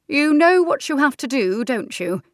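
high, loud pointed question; knowing aunt. Acted. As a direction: prod her like a wise aunt. Aunt Senna to Louise.

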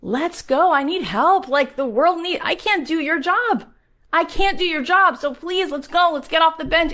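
high and loud, pleading to get moving; urgent plea. Retold or imitated. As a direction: push them off the bench, urgently. Her own plea to listeners.

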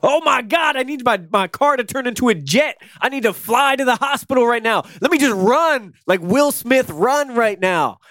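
high, frantic voice; panicked. Pretend. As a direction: play it like a panicking dad. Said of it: "I would be like".

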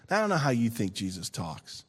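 ordinary talk

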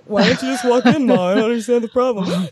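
lightly voiced puzzled dog; a hint of a clueless dog talking; dumb dog voice. Dog stares through the window at its owner, lightly voiced puzzled dog. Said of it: "classic dumb dog look on his face, like".

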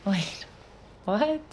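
sharp high startled question; startled what. Acted. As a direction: caught off guard as he says he's leaving. Sudden news he must leave.

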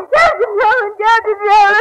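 frantic cry for help. Acted. Wife finding her murdered husband, crying out to the captain in panic. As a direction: call out his name in panic.

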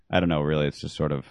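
ordinary delivery

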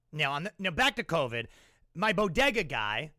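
normal talking voice

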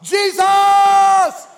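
high, sustained, full-force shout; crying out everywhere. Acted. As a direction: holler to someone you can't locate. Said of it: "he starts scattering it like birdshot".

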